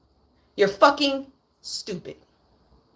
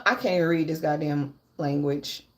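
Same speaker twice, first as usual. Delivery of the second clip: lower, much softer grumbled aside; 8 semitones lower, 8 dB quieter than usual. Real, not acted. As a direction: mutter to yourself. Muttering over unreadable letter.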